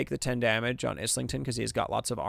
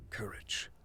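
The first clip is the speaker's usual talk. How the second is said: breathy whisper of one word; 2 semitones lower, 12 dB quieter than usual. Real, not acted. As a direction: whisper it to yourself. Whispers option name.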